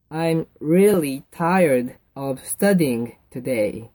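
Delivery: very slow, word by word; slow model reading. Shown as a demonstration. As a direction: spell it out slowly for learners. Model answer repeated slowly for learners. (一語ずつゆっくり読み上げる)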